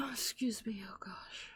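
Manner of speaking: breathless whispered excuse me; hushed stunned whisper; whispered shock. Real, not acted. Shocked aside.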